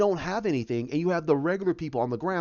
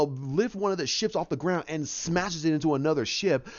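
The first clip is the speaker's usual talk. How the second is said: ordinary delivery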